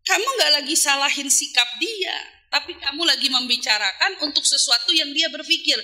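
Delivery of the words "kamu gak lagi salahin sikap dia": ordinary talk, nothing marked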